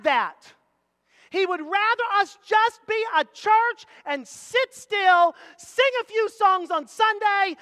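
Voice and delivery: high, loud, hammering key words; full-force preaching. Real, not acted. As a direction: preach it hard to the congregation.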